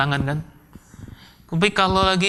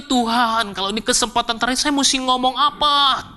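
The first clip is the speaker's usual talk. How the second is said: higher-pitched, pleading inner cry; anguished plea to God; 7 semitones higher than usual. Retold or imitated. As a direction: retell a desperate, struggling prayer. Retelling his anguished prayer.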